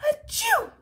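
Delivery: high loud open sneeze. Shown as a demonstration. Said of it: "should he have sneezed like this".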